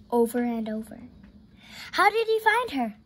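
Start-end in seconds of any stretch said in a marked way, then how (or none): 1.9-2.9 high, eager question; amazed boy asking. A narrator's reading. Jake asks Katie about his kitten, high amazed question. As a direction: lift the voice in wonder. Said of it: "he asked".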